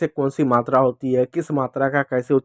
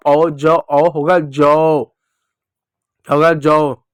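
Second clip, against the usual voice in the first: very slow drawn ज औ; slow syllable demo; 4 dB louder, much slower than usual. Shown as a demonstration. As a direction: stretch out जौ for kids. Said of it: "क्या होगा?". (बहुत धीरे जौ)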